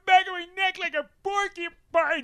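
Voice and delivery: very high, loud excited screech; hammed-up imitation of a thrilled friend; Wayne. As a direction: shriek it in mock-hysterical excitement. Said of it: "this is the response".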